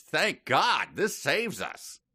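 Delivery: a lightly voiced Edison, relieved. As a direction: draw it out like a grateful old inventor. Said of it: "set me up again".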